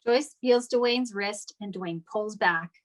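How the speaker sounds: ordinary talk, nothing marked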